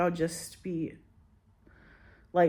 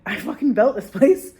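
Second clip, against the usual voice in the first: loud indignant outburst, higher; 5 semitones higher, 10 dB louder than usual. Retold or imitated. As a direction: shout it like a furious star. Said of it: "[name] was like".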